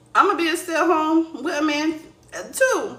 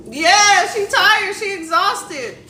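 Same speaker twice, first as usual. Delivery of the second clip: mocking; 8 semitones higher, 5 dB louder, much slower than usual. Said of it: "she was like".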